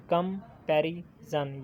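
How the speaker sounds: Comparison said slowly, syllable by syllable; slow syllable count. Shown as a demonstration. Teacher counting syllables for students, slow and spelled out. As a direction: stretch out comparison so each syllable can be counted. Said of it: "ये भी three हैं".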